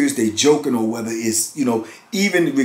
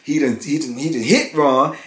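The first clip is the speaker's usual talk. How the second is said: normal talking voice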